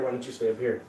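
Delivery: ordinary delivery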